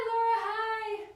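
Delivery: high-pitched, sing-song hello; delighted greeting. Real, not acted. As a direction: greet a friend in a high, happy squeal. Greeting a viewer who joins.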